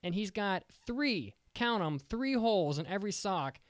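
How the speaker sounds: usual speaking voice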